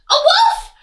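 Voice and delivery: very high, loud panicked piglet; terrified piglet shriek; pig crying out. Acted. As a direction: shriek the alarm. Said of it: "Pig cried".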